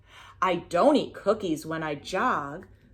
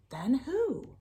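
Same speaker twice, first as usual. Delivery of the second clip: whispering; 5 semitones higher, 6 dB quieter than usual. Acted. Whispered question in rhyme.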